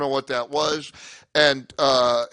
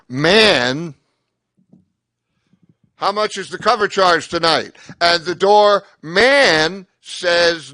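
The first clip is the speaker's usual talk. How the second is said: loud, slow punchline telling; joke delivery; 3 semitones higher, 11 dB louder than usual. Acted. Comic telling his PC joke to the crowd, loud deliberate delivery. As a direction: lean into the setup and land it. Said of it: "This is a great P C joke".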